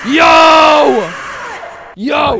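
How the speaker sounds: loud, high, drawn-out holler; excited yell. Real, not acted. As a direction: bellow it out in hype. Reacts to donation alert.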